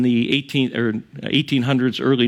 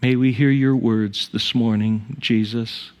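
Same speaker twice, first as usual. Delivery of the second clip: unhurried prayer with long pauses; measured, pause-filled pastoral prayer. Real, not acted. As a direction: pray reverently, leaving silences between phrases. Leads the congregation in prayer.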